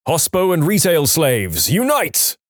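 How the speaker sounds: slow build to a high-pitched final word; mock rallying slogan, rising sharply at the end; rallying cry. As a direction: proclaim it like a call to arms. Mock rallying cry to fellow workers.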